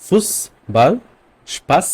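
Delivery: "ফুসবাল" and "স্পাস" with a sharp hissed ss; hard double s. Shown as a demonstration. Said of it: "জোর দিয়ে বলা হচ্ছে".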